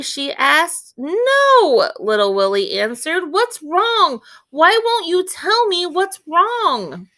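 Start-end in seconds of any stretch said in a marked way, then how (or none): 1.0-1.9 put-on high, loud child's no; a startled boy's denial. A narrator's reading. Willie answers the doctor, high and loud. 3.3-7.1 put-on high, slow pleading child; worried boy. A narrator's reading. Willie begs the doctor, high and slow.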